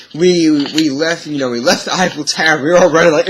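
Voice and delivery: ordinary delivery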